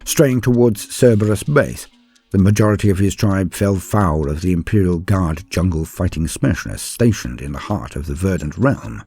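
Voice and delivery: ordinary delivery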